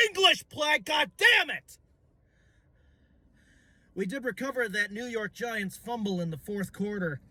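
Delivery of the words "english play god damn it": high, cracking curse; frustrated shout. Real, not acted. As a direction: blurt it out in frustration. Frustrated at own stumbling.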